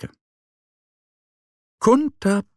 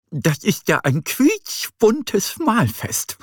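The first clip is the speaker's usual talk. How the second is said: higher, slow delighted exclaim; delighted bunny; 10 semitones higher, slower than usual. A narrator's reading. Easter bunny delighted by all the painted eggs, higher and slow. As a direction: savor the colourful fest. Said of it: "sagt er".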